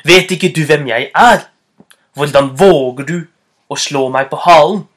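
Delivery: loud, stern scolding; strict fox telling off a tiger; sternest voice. Acted. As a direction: demand it indignantly at full voice. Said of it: "med den strengeste stemmen han kunne".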